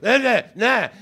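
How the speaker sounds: high, loud wordless mock protest; mimicked chef's tone. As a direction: imitate an arguing chef by tone alone. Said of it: "the tone I heard".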